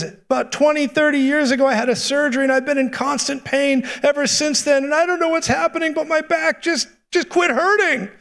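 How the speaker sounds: high-pitched impersonation of an amazed woman; excited healed lady. Retold or imitated. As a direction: testify in a high, acted lady's voice. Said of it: "she stands up and says".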